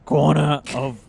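loud gruff detective growl; noir Batman. Acted. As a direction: bark it like a brooding vigilante. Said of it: "yeah, Batman, but with, like, a hint of noir".

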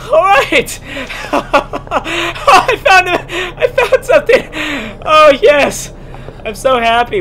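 high, loud cheer with a laugh; giddy loud relief; overjoyed cheering. Real, not acted. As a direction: celebrate the find at full voice. Finds item, celebrates.

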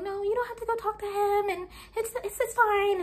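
high, soft and meek; a timid younger self backing down; timid weenie voice. Retold or imitated. Timid little sister declining her big sister's offer, meek and soft. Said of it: "I was like".

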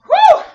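loud high whoop; cheering whoo. Real, not acted. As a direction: whoop to pump everyone up. Cheering during set.

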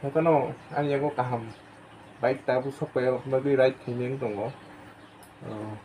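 muffled through helmet shell; talking while buckling helmet; speaking inside a helmet. Real, not acted. Seen 0: Face seen inside the full-face helmet, hands at the chin strap.

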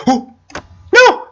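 very high, loud cry of failure; yelling no as it goes wrong; anguished shout. Real, not acted. Fails the jump.

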